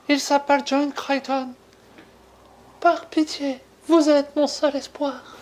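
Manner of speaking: jeune fille paniquée, voix bien plus aiguë; voix de Léa. Acted. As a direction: supplier le docteur d'une petite voix aiguë. Suite de l'appel de Léa. (Léa appelle le docteur à l'aide, voix aiguë suppliante)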